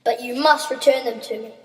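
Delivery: plain reading voice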